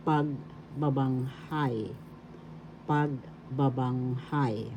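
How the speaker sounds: pagbabanghay slowly, drawn-out final HAY; slow pag-babang-HAY for pagbabanghay, weight on the end; stress on last syllable. Shown as a demonstration. Teacher introducing the Tagalog word, slow pagbabanghay stressed on HAY. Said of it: "The accent is in the fourth syllable".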